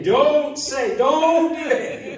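Holy Spirit's warning. Retold or imitated. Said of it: "feel the Holy Spirit saying".

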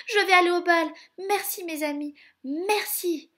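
high, loud burst of joy; overjoyed. A narrator's reading. Cinderella learning she can go to the ball, overjoyed. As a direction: exclaim thanks with elation.

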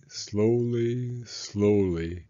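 plain reading voice

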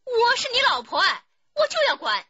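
high, loud furious yell; wife shouting at her husband, high and loud; angry shout. Acted. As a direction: scream your rights at him.

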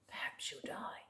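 hushed spooky whisper. Acted. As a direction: whisper the line to the child. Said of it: "whispered refrain".

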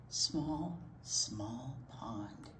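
low breathy whisper; whispered rhyme. Acted. Librarian whispering the rhyme to storytime kids. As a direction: murmur it softly, very low.

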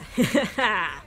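short burst of pleased laughter; happy laugh. Real, not acted. Player celebrating the win, happy laugh. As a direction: laugh in triumph.

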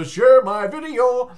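slow, high, loud stretched call; drawn-out shout. Retold or imitated. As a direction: belt it out slowly. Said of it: "I go".

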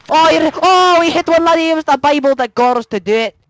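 excited Irish brogue settling down from a cry; excitable stage-Irish accent; mock Irish. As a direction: exclaim in hammed-up Irish, then ease off. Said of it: "speaking Irish".

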